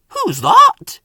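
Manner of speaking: startled ask; gasped question. A narrator's reading. Toby seeing Flora, startled gasp. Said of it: "he gasped".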